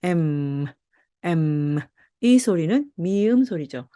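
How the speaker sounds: usual speaking voice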